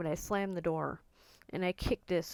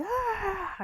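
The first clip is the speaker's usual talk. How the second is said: high, contained mock scream; retold wordless scream; 14 semitones higher than usual. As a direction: let out a short high scream without full volume. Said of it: "I'm just screaming".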